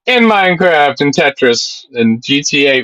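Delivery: ordinary delivery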